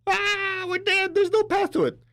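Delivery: high, crying mock lament; mock wail of despair. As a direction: wail it like all hope is lost.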